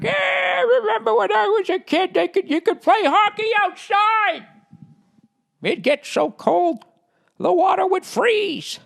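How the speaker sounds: put-on a cranky old man bragging about the good old days; old grandpa. Future grandpa telling his grandkids about frozen ponds, a high creaky old-man voice. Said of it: "And I'll be like".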